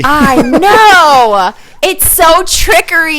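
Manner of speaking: hammed-up indignation; playful exasperated protest. Real, not acted. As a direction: own up to it with a wail. Mock protest at being called lazy.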